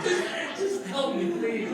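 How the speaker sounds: high, laughing mock plea; half-laughing fake desperation; mock pleading. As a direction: beg for help, hamming it up. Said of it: "I need help".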